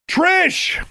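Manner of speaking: very high, full yell of a name. A narrator's reading. Heroine calls to girl fallen off the cliff, yelling. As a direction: yell far down into the distance. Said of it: "Alexandra yelled".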